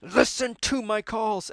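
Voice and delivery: high, slow, drawn-out plea; pleading co-driver. Retold or imitated. Co-driver begging Sammy to listen, slow and high. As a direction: stretch every word like a desperate plea. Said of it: "And he's like".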